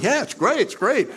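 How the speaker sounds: very high, chirpy fake delight; mock cheerfulness. Ego picturing itself happy walking around, mock cheerful. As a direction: parody someone blissfully happy, high-pitched.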